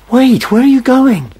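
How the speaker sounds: shouted high and loud after someone leaving; alarmed shout. Acted. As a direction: call out in alarm as they walk away. Calling after departing questioner.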